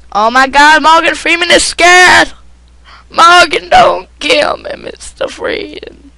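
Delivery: mock-scared impersonation, high and loud; Morgan Freeman. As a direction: cry out in hammed-up fright, stretching the last words. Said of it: "I lost my Morgan Freeman impersonation".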